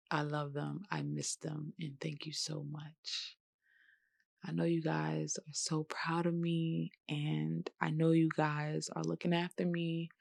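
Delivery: a daughter whispering love to her late dad; tearful tribute. Real, not acted. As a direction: speak barely above a breath, holding back tears. Tribute to her late father and friend.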